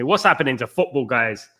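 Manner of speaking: ordinary talk, nothing marked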